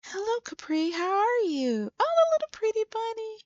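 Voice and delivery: put-on very high baby-talk hello to a pet; put-on squeaky fussing voice; cooing greeting. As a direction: coo high at a little bunny. Sim cooing hello to a bunny.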